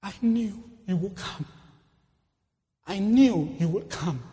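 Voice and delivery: halting, drawn-out last words; dying man's words. Acted. Dying soldier thanks the friend who came, halting last words. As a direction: let each repetition out with long pauses. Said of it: "In his dying moments".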